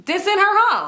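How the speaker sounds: loud, high exclamation; retold exasperated retort.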